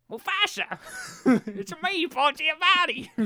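high-pitched comic character voice; hammed-up, squealed self-introduction; Paul Giamatti impression. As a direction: put on a high, silly actor impression. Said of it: "he would just be like".

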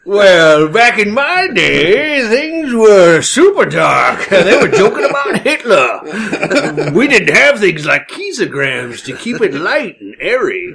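put-on high, slow old-timer voice; creaky old prospector drawl; old-timey codger. Old man back in my day.

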